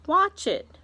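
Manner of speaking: loud, high warning to watch; excited command. Acted. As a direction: snap their eyes to it. Aunt urging others to look.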